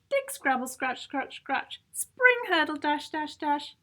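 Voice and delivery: ordinary delivery, nothing marked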